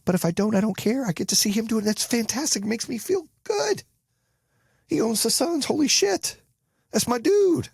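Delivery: very high, elated gushing; thrilled for friend. Real, not acted. Celebrating his dude owning the Suns, elated. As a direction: let pride in your buddy send the voice soaring.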